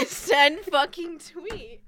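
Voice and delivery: wheezing laugh; laughing, slow and high. Real, not acted. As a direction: laugh the words out slowly. Laughing repeat of friend's line.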